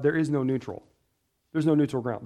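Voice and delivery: ordinary delivery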